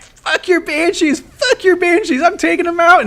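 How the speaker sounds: high-pitched trash-talk jeer; mock rage taunt. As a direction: spit it out like a gloating gamer. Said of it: "I'm just like".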